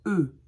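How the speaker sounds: "E" as a plain French "eu" vowel. Shown as a demonstration. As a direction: say "E" as an open "eu", no R sound. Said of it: "vous dites pas R, vous dites E".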